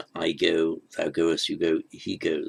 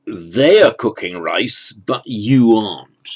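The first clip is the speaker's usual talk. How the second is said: "They" and "you" hit louder and slower; stress on they and you; 9 dB louder, slower than usual. Shown as a demonstration. Teacher contrasting they and you, stressed and slow. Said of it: "so you emphasize they're doing one thing".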